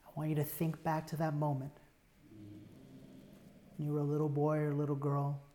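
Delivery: normal talking voice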